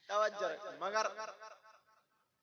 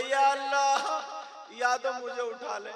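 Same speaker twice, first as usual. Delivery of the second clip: raised, higher-pitched cry to God; fed-up husband wailing out a prayer; angry husband's plea; 8 semitones higher, 10 dB louder, slower than usual. Acted. Harried husband praying to be taken away, angry loud plea. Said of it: "गुस्से में आगे बीबी को कहने वाला था".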